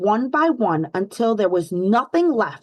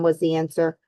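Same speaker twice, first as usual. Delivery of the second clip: ordinary delivery, nothing marked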